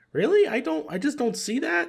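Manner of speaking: mock-incredulous retort. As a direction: scoff at the news in disbelief. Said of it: "But I'm like".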